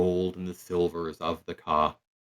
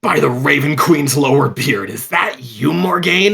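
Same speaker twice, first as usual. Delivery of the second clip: higher, louder, amazed exclamation; Garrow's surprised greeting; 6 semitones higher, 8 dB louder than usual. Acted. Garrow spotting Morgaine in the crowd, loud surprised. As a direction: burst out in delighted disbelief. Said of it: "takes a step and goes".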